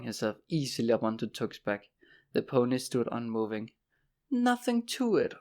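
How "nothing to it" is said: high, boastful crow. A narrator's reading. Horace boasting from the pony's back, crowing. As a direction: crow over an easy win. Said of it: "Horace crowed".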